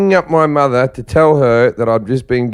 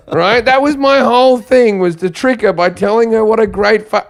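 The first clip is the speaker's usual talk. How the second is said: ordinary talk, nothing marked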